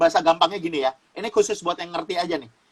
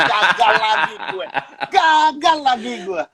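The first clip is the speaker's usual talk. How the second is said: frustrated devil; 2 semitones higher, 4 dB louder, slower than usual. Retold or imitated. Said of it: "Dia bilang".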